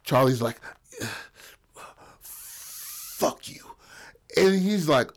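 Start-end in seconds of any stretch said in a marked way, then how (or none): 2.2-3.6 strangled, voiceless croak; acted choking, barely any air; being choked. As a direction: squeeze out a faint whisper as if throttled. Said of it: "being choked".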